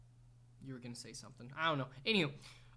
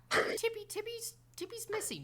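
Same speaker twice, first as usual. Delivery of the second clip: put-on high, fretting old lady voice; worried old woman missing her cat; dotty absent-minded old lady. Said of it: "a woman being like".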